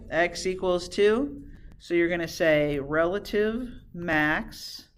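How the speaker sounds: very slow, stretched words with gaps; slow dictation. Real, not acted. Teacher dictates the answer to class, slow dictation. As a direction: leave time to write each word.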